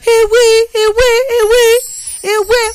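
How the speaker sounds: high, repeated wail of mock alarm; exclaiming in mock shock. Host reacting to a scandalous message, wailing in mock shock.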